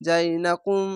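ordinary delivery

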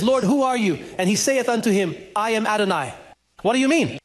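usual speaking voice

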